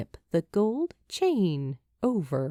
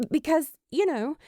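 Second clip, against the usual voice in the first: higher and fast, flustered backpedal; hasty stammer; 6 semitones higher than usual. Acted. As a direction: rush it out, tripping over the words. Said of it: "she stammers a bit when she hastily adds".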